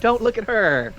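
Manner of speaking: high, pleading calls to draw his gaze; pleading distraction. Retold or imitated. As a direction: look at me, look at me, urgent and high. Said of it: "like, like".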